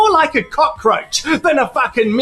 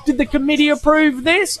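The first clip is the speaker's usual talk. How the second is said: usual speaking voice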